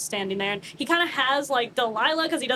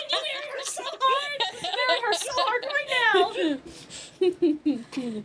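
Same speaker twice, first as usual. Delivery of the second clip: high laughing shout, slow at the end; laughing mock order; 11 semitones higher, slower than usual. Arminius ordering Brock to marry her, laughing and high. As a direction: bark the order through laughter. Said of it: "He said".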